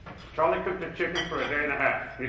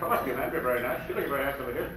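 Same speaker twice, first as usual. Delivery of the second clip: ordinary talk, nothing marked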